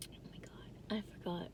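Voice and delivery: real whisper to herself; whispered realisation. Real, not acted. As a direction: whisper it as the thought slips away. Lost her train of thought.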